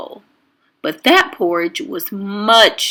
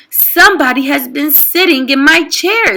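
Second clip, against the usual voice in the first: indignant Papa Bear; 5 semitones higher, 4 dB louder, faster than usual. A narrator's reading. Papa Bear finds chair.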